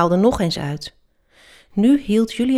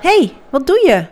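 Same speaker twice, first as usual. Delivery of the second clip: indignant shout, higher and louder; shouted in protest; 6 semitones higher, 6 dB louder than usual. A narrator's reading. Felix yells at his attacking friend Julius, shouting. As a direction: cry out high and loud in alarm. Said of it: "Schreeuwde Felix".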